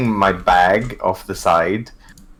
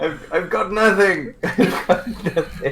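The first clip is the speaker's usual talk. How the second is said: high voice breaking into laughs; helpless laughter; 11 semitones higher than usual. Real, not acted. Player out of options after bickering, helpless laughter. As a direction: admit it while cracking up.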